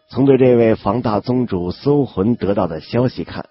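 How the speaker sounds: ordinary delivery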